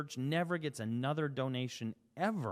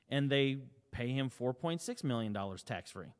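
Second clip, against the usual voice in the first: ordinary talk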